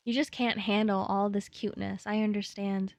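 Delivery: normal talking voice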